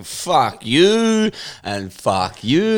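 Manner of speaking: very slow, drawn-out imitation of a ranter; mock carrying on. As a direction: stretch each word out, mimicking his rant. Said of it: "his guy just carrying on".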